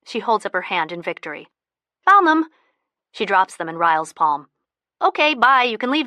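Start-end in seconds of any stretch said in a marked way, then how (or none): 2.0-2.5 high, loud burst of victory; triumphant. Acted. Elisa finds keys triumphantly. 5.0-6.1 hurried dismissal. Acted. Elisa rushing Ryle out.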